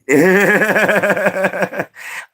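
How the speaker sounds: high-pitched burst of laughter. Real, not acted. Streamer cracking up at his own voice, high laugh. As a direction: break into a high laugh at your own bit.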